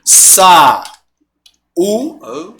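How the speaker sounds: "Sa-, u-," as two isolated syllables; syllable separation. Shown as a demonstration. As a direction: pause between SA and U in "Sa-, u-,". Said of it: "Como é que eu separo saúde?".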